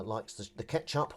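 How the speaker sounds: ordinary talk, nothing marked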